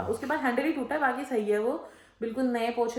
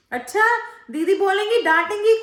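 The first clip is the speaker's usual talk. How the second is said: mimicking the maid; 10 semitones higher, 10 dB louder than usual. Maid's cheeky reply mimicked.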